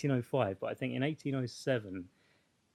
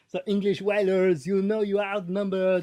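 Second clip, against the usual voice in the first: put-on French accent; 5 semitones higher, 5 dB louder than usual. Acting French ship captain.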